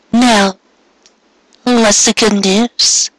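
high, slow and curious, drawing out the question; a gentle mare coaxing out the good news; light mare voice. Acted. Mare asks Carrot in bed.

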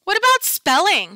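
put-on high, loud kid's shout; a boy shouts a question at the teacher. A narrator's reading. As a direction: blurt it out loud. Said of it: "shouts Randy Potts".

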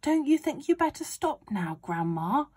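calling out. A narrator's reading. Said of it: "George called out".

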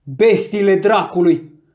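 drawn-out furious curse; angry outburst. A narrator's reading. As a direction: burst out cursing the dogs. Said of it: "explodă el".

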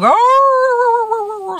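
high, long-held mock howl; sustained high dog howl; imitated dog wail. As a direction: howl like a crying hound. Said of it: "crying at the top of his lungs".

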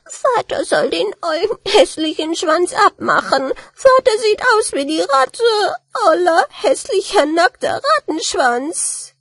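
put-on small child wailing in a high voice; weinerliches Kind. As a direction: whine and cry out like the littlest kid. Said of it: "fing an zu weinen und rief". (heulendes kleines Kind, hohe Stimme)

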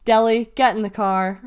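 loud, firm police order. A narrator's reading. Policewoman ordering Delly into car, ordered. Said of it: "when the policewoman ordered".

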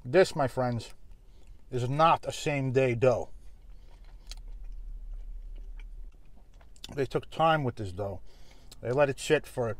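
words muffled between bites; chewing while talking. Real, not acted. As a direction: mouth partly full of food. Seen 1: Bites slice at -5; cheeks full, chewing lips while talking throughout.

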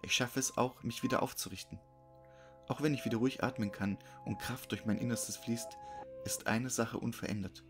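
ordinary delivery

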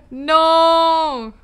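loud, high cry of dismay; exasperated, drawn-out shout. Real, not acted. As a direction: wail it out in frustration. Raid targets keep ending streams.

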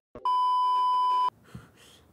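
shrill burst of laughter; high laugh. Real, not acted. Player laughing at their own bit, high-pitched. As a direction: crack up at the joke.

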